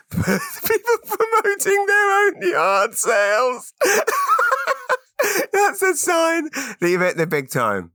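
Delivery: high, slow words through big laughter; laughing. Real, not acted. Podcaster laughing at promoting his own yard sale, real laughter. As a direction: crack up mid-sentence.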